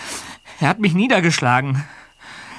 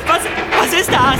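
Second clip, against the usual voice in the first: alarmed shout; 8 semitones higher than usual. Acted. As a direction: cry out in alarm. House collapsing.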